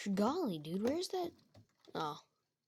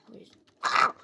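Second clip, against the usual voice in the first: pained yelp; 9 dB louder than usual. Acted. Chica stubs her toe.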